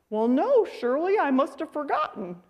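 a hint of a husband playing innocent; mock-innocent husband. Dad answers scolding wife.

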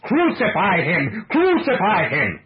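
loud, high, drawn-out cry of a furious crowd; full-voiced, high-pitched angry outcry; awful mob cry. A narrator's reading. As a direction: cry out slowly like a mob demanding death. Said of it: "raised that awful cry".

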